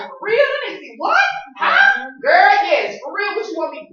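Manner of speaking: high, loud, egging her on; shouting like a friend ready for revenge; hyped homegirl. Retold or imitated. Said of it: "She like".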